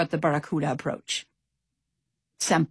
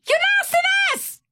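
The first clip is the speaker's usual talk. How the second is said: very high, thrilled squeal; excited shout; 4 dB louder than usual. A narrator's reading. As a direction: squeal it out in delight. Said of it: "he shouted excitedly".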